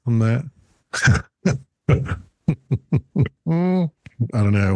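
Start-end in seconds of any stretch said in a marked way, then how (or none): 2.5-4.3 laugh. Real, not acted. Reverb joke.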